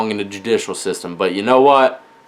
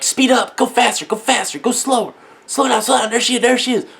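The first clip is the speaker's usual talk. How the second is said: put-on high, eager kids' voices shouting directions; excited kids; 9 semitones higher than usual. Kids in the back seat urging their dad: high excited voices.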